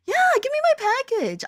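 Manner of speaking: high, squeaky mock demand; retold demand. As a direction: put on a high, insistent complainer voice. Demanding package on retold call.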